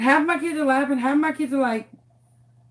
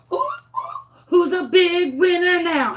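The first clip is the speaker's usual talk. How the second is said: hyped teacher cheer; 7 semitones higher than usual. Retold or imitated. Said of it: "I'm like".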